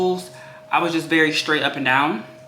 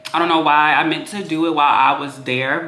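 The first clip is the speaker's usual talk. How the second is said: ordinary talk, nothing marked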